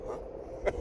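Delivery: a soft, breathy, baffled huh. Retold or imitated. Nurse baffled at the unexpected offer, soft whispered huh. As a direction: react as if you misheard.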